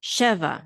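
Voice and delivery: Sheva stressed on SHE, falling away on va; stress on first syllable. Shown as a demonstration. Teacher demonstrating the accent mark to learners, first-syllable stress on Sheva. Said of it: "the throat mark is on she".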